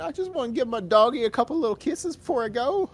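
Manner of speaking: high, soft mock plea; pitiful dying voice. Voicing the dying man's last wish: high soft mock plea. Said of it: "voicing dying man".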